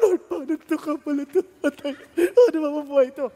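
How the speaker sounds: tearful, hammed-up wail of worry; mock crying. New father of twins praying in panic, mock crying. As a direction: imitate crying out a worried prayer. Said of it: "iyak ako".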